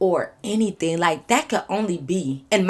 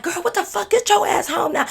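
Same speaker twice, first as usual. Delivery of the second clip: high-pitched, urgent best-friend voice; imitated scolding friend; 13 semitones higher, 4 dB louder than usual. Bestie on a late call to the storyteller, imitated high scolding. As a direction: order her home in a high, alarmed voice.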